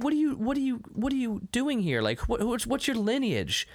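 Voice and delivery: hammed-up scolding in a high register; mock yelling. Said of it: "yelling at me like".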